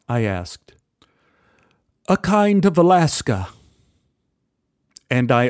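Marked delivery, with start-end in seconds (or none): 2.1-3.5 lightly English, higher and louder; a polite English gent's greeting; English accent. Retold or imitated. English stranger greets narrator at the bar, lightly English. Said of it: "He, he was English".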